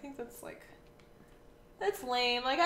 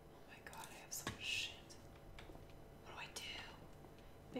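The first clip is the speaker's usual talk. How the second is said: whispering; 17 dB quieter than usual. Real, not acted. Whispering over her inventory.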